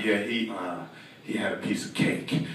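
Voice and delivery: low, soft, halting reply; sheepish admission. Acted. Guilty teacher to the boy's father, low sheepish mumble. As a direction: own up quietly, hesitating mid-sentence.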